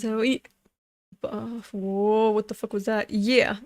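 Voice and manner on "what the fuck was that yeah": ordinary delivery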